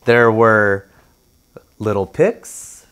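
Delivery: plain reading voice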